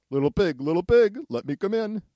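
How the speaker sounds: high, loud wolf call. Acted. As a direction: call through the door like a wolf. Wolf demands entry.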